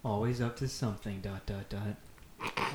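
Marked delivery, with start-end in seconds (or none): 1.2-2.7 drawn out, very slow. Acted. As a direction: stretch each dot out slowly. Reads ellipsis aloud dramatically.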